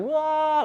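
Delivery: high-pitched mock wail of crying; imitated crying wail. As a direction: cry out a long, high "wah". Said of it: "he was crying".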